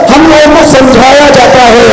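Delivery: ordinary delivery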